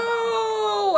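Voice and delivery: high, sharp mock scream. Ebony screaming at the news, mock scream. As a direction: cry out no. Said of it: "I screamed".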